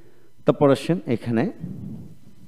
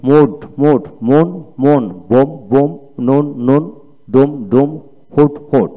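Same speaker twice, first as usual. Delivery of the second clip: reading drill. Shown as a demonstration. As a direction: call out every word twice for the class. Teacher reads drill words twice.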